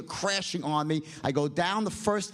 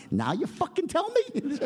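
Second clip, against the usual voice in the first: incredulous outburst; 10 semitones higher than usual. Retold or imitated. Captain reveals storms are common.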